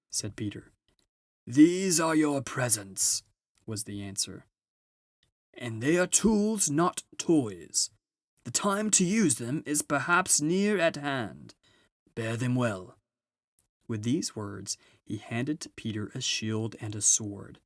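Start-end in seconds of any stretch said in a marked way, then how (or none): 1.5-13.0 high, booming jolly Father Christmas; a grand, merry old gift-giver arriving at last; Father Christmas announces his arrival to the children. Acted.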